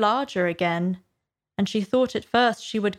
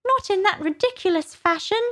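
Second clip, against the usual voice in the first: very high-pitched Dormouse; high storybook Dormouse; Dormouse retorting; 12 semitones higher than usual. A narrator's reading. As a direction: object up high as a huffy little creature.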